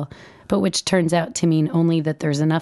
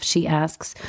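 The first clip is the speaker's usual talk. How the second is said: ordinary delivery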